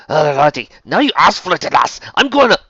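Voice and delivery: loud, high Scots threat; threatening. Acted. Villain back on his feet threatening the girl, angry and loud. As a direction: rise into an angry, cut-off threat.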